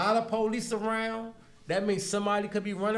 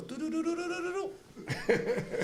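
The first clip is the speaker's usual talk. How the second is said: mock beeping noise; 9 semitones higher, 3 dB quieter than usual. Imitating the test kits changing.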